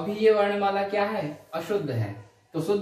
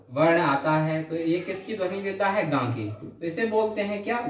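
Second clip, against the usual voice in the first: ordinary delivery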